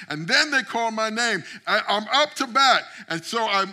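rising from lively to shouting; building preaching. Acted. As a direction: build the sermon to a roaring climax. Dream story building to climax.

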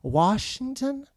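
drawn-out, high questioning "Washington?"; mishearing listener. Retold or imitated. Imitate someone querying a misheard name, slowly. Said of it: "They say".

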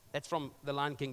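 normal talking voice

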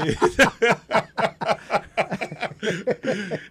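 a loud burst of real laughter; hearty laugh. Real, not acted. Laughs at Madison Square Garden joke.

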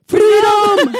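high, loud Scottish "Freedom!" battle cry; Scottish Braveheart cry. Pretend. Answering a listener's request, a loud Scottish battle cry. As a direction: bellow it like a Highland warrior. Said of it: "They requested this in an Irish Scottish accent".

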